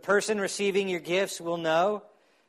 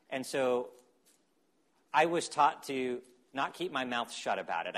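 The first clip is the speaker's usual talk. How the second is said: normal talking voice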